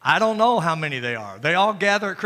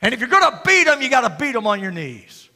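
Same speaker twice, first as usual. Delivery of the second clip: loud, high fervent preacher climb; preaching at full force; 8 semitones higher, 8 dB louder than usual. Acted. Pastor rousing the congregation, full-force preaching. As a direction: drive it home from the pulpit.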